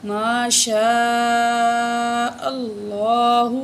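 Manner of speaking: slow chanted ما شاء اللہ; recited slowly like tilawat; melodic recitation. Shown as a demonstration. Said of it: "teacher models recitation".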